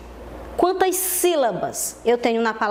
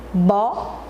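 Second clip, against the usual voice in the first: "Bola" split into bo, pause, la; teacher counting out "Bola" in two beats; slow syllables; 2 semitones lower than usual. Shown as a demonstration. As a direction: separate the two syllables of "Bola", lifting the first. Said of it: "Vamos falar bem devagar".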